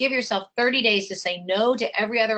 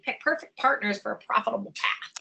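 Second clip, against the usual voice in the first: tongue-twister fast. Shown as a demonstration. Teasing Sandy with the alliteration, tongue-twister fast. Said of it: "Say that ten times fast".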